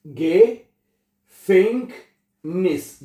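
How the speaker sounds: "Gefängnis" stretched very slowly, each sound pronounced separately; broken into syllables. Shown as a demonstration. Teacher shows students how to break long German words apart, stretched slowly. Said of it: "तो उन अल्फाज को आप तोड़ के पढ़ें".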